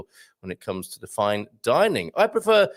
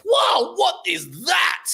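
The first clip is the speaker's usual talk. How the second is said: mock king's shout; 4 dB louder, much slower than usual. Xerxes opens the gift box.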